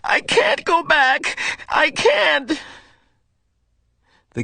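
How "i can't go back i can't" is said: high, slow, despairing shout; boy in despair, crying out; desperate cry. Acted. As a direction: cry out in panic.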